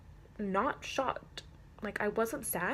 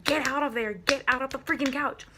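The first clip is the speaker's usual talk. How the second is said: raised, loud mock scolding; retold yelling; 4 semitones higher, 5 dB louder than usual. Owner to hamster under the couch, retold yell. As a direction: re-enact yelling at a stubborn pet. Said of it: "yelling at my hamster".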